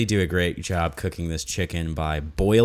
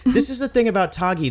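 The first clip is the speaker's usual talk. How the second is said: high announcer voice; faster than usual. Pretend. Continues host voice.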